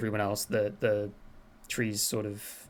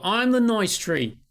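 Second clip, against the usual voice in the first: high and slow, sweetly; a slow, high, self-pleased tree; talking tree; 10 semitones higher, 6 dB louder, slower than usual. Acted. Tree introduces itself to the player, high and slow. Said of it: "All four trees go".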